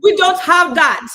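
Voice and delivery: loud, high, slow Caribbean "We don't have that"; rude Caribbean server. Retold or imitated. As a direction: bark it like a rude restaurant worker. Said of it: "So they say".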